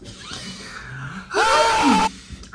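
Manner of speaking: sharp high gasp of shock. Real, not acted. Reacting to the Taco Bell confession with a high shocked gasp.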